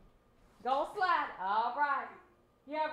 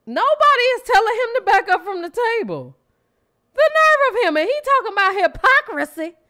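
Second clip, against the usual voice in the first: much louder and higher outrage; loud indignant rant; 9 semitones higher, 12 dB louder than usual. Real, not acted. Co-host loudly insisting someone tell him to back up. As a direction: shout your indignation.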